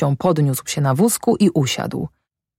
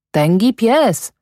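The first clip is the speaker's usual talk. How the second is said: higher, drawn-out exclamation; mildly raised boyish call; 7 semitones higher, slower than usual. A narrator's reading. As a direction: call it out slowly, pitched up. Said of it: "zawołał Jędrek".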